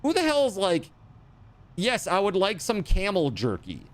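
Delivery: high, disbelieving mock question; incredulous mock voice. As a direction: scoff at it in a high voice. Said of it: "And I'm like".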